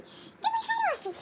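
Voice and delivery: very high, slow, drawn-out dog praise; squeaky praising a dog. Real, not acted. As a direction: stretch it out sky-high, like doting on a puppy. Praising the dog after fetching.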